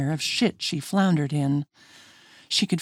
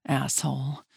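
under the breath, much softer; muttered curse; 2 semitones lower, 6 dB quieter than usual. A narrator's reading. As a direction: mutter it to herself. Muttered curse after hangup.

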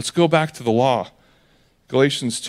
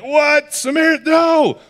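high, loud outraged disbelief; shocked outburst; 12 semitones higher, 5 dB louder than usual. Retold or imitated. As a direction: shout it like minds exploding. Crowd's shocked reaction acted out.

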